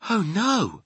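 put-on high-pitched little girl's dismay; dismayed cry. A narrator's reading. Sophie dreads the nightmare, high dismayed cry. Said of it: "Sophie cried".

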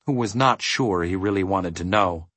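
ordinary delivery

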